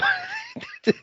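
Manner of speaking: squealing laugh, way up high; high burst of laughter. Real, not acted. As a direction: let a high laugh break out. Laughing at plot.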